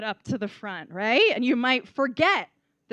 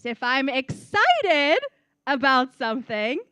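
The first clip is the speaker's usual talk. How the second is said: excited demo; 2 semitones higher, 6 dB louder than usual. Shown as a demonstration. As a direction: demonstrate excitement, stretching the key word. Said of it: "I want my voice to go up".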